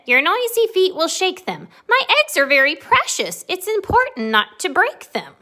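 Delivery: high, loud fussing hen; anxious storybook hen. A narrator's reading. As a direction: scold the bouncy bunny, pitched high. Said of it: "Chicken said".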